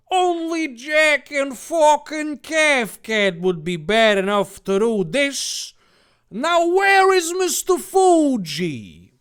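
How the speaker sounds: high, loud, slow villain shout; villain yell. Acted. Jack yelling at Dave, slow and loud. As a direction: roar it like a cartoon baddie. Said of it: "Jack yelled".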